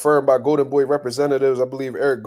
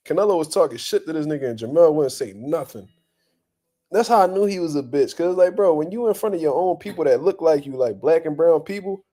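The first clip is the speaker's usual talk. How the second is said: ordinary talk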